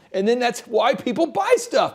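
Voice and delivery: ordinary talk, nothing marked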